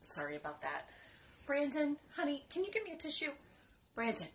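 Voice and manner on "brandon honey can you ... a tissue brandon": calling out. Real, not acted. Calling son for tissue.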